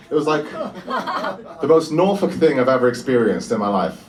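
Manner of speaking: usual speaking voice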